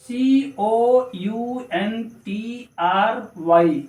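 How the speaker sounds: very slow, evenly spaced letters; spelling out. Shown as a demonstration. Teacher spelling country for learners, slow letters. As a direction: dictate it one letter at a time.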